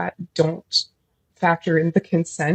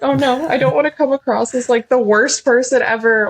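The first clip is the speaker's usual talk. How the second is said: scared; 6 semitones higher, 5 dB louder than usual. Retold or imitated. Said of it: "'cause I was scared".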